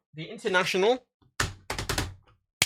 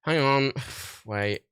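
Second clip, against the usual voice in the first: ordinary talk, nothing marked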